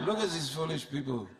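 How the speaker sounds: mock French observer scoffing at chanting devotees; French accent. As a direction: sneer it in a put-on French accent, laughing. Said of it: "It's my French accent".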